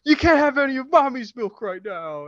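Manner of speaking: high, loud exasperated scolding; a father at the end of his rope; fed-up dad. Retold or imitated. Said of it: "Kevin James is, like, kinda had it".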